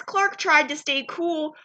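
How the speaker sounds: ordinary delivery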